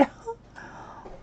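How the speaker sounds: high, breathy giggle; soft high giggle; breathy laugh. Real, not acted. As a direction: giggle in disbelief. Laughing at the change.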